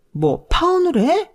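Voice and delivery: high, slow incredulous cry; can't believe what she just heard; slowed, shrill-free shocked question; outraged disbelief. Acted. Future mother-in-law hears of breakup.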